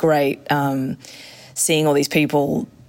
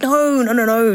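emphatic no; 8 semitones higher, 3 dB louder than usual. Retold or imitated. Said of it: "And it's like".

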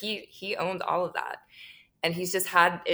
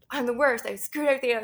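higher, louder self-pitying wail, hammed-up; mock self-pity; 6 semitones higher, 4 dB louder, faster than usual. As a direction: whine that you're hopeless, half joking. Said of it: "with the most negative attitude and be like".

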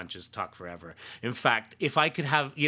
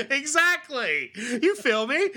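laughing; 14 semitones higher, 5 dB louder, slower than usual. Pretend. Laughing, Funches-style reply to joke.

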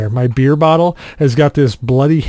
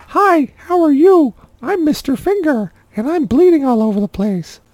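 silly finger voice; 13 semitones higher than usual. Retold or imitated. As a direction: give your finger a goofy little character voice. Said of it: "talking to myself with my own finger".

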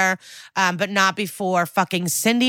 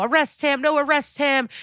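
mock kiddie bickering, pitched way up; high sing-song tit-for-tat, imitating squabblers; grade-school squabble; 8 semitones higher than usual. Recapper mimicking rivals demanding each other's arrest, mock childish squabble. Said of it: "very grade school vibes now".

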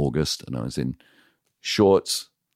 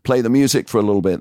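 raised announcing voice, higher and louder; calling out to the club; 10 semitones higher, 6 dB louder than usual. Retold or imitated. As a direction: call it out over the club like a host.

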